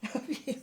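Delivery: short villainous chuckle; queen gloats once her ally has gone; scheming laugh. Acted. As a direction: laugh to yourself at the plan. Plotter alone after ally leaves.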